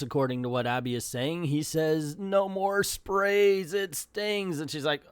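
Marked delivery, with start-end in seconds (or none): 2.2-4.6 higher, slow squeaky little voice; a small plant creature's first words, squeaked slowly; a creature just grown a mouth, squeaked out. Acted. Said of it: "in a very quiet and squeaky voice".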